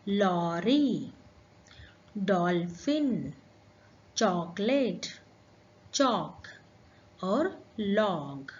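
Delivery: slow, careful "लॉरी", "डॉल्फिन", "चॉकलेट", "चॉक", "लॉग" with the open ॉ vowel between आ and ओ; ॉ shown slowly and clearly for pupils. Shown as a demonstration. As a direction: draw out the ॉ in "लॉग" and "चॉक" like a teacher modelling. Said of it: "दिए गए शब्दों का शुद्ध उच्चारण कीजिए".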